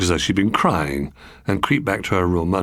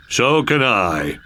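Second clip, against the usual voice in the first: smug frog; much slower than usual. Acted. Third frog not outdone.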